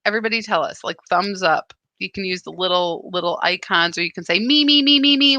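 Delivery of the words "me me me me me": higher, louder eager "me, me"; eager volunteering. Pretend. Host voicing the audience eager to volunteer, excited calling out. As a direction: call out like a keen volunteer waving a hand.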